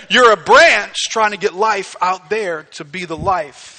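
loud, urgent sermon voice with rising pitch; full-force preaching. Acted. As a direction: preach it hard, piling up the worries.

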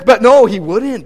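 ordinary talk

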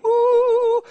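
high eerie woo sound; a ghostly rising woo for the energy creature; spooky woo. Acted. Preacher giving the energy being a high, spooky woo.

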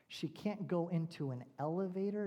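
normal talking voice

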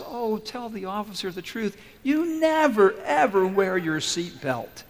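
high, loud NEVER, then drawn-out words; exasperated tattling wife. Acted. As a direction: tell on your husband with mock exasperation. Wife tattles in retold joke.

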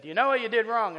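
a touch of a stern cop asking; lightly voiced officer questioning.

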